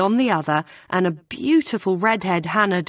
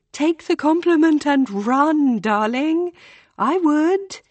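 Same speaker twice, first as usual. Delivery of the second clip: much higher, breezy and posh-sounding tease; airy April; 10 semitones higher, 4 dB louder than usual. A narrator's reading. April advising Hannah to take the compliment, airy and higher. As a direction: toss it off lightly.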